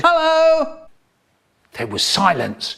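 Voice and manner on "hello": sudden very high squeak of a greeting; a strange desert camel piping hello, much higher; talking camel voice. Acted. Camel greets the stunned brothers in a very high squeak.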